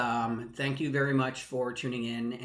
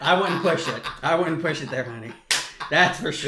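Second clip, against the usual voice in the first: laughing; 2 semitones higher, 6 dB louder than usual. Real, not acted. Laughing reply to a joke.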